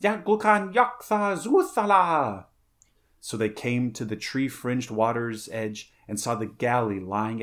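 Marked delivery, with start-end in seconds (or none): 0.0-2.4 high, loud, drawn-out parrot cry; eerie parrot call. A narrator's reading. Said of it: "Somewhere a parrot called, like an eerie echo".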